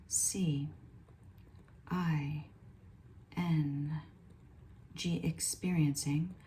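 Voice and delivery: each letter whispered apart with long gaps; slow spelled channeling. Acted. Channel spelling the spirit's message, hushed and slow.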